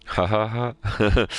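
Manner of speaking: loud, slow, forced ha-ha-ha; fake laugh. Shown as a demonstration. Humouring a coworker's Family Guy joke, loud fake laugh. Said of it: "I gave my best fake laugh".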